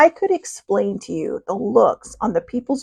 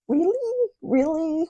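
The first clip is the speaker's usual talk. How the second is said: higher, mock disbelieving really; high incredulous really; 7 semitones higher, 6 dB quieter, slower than usual. Narrator mocks her sister's sugar pouring, incredulous high really. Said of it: "I'm just sitting here like".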